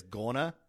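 "gonna" with a Brooklyn open o. Shown as a demonstration. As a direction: say "gonna" as kaw-na. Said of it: "she says".

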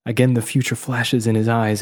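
usual speaking voice

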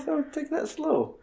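high, put-on bashful voice; coy dodging voice. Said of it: "he's just no telling us".

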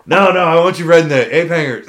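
mock drill instructor to a recruit. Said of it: "He goes".

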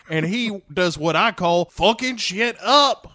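high, fast brash general; gruff military man. Retold or imitated. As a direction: hype it like a cocky general. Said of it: "Buster Scruggs is narrating".

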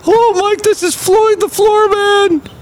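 extremely high squeaky character voice; very high cartoon voice. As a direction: squeak it like a tiny cartoon creature. Puppet introduces Floyd.

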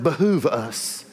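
"Behoove" slow and low, lingering on it; savoring the word. Shown as a demonstration. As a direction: relish the word "Behoove" slowly. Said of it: "don't you love that word?".

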